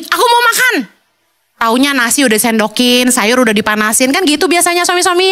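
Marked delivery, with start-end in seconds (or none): 0.0-0.7 high, curt demand for food; demanding husband. Retold or imitated. Husband ordering wife to serve dinner, curt and high. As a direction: bark an order like a lazy husband.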